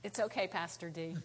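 calm the pastor down; soothing. Retold or imitated. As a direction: Sam quietly reassuring the pastor. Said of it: "Sam walked up because".

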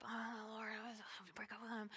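very soft, whispered lament; hushed, acted-out reluctance; reluctant whispered plea. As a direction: whisper it like a reluctant confession to God. Retold reluctant reply to the Lord.

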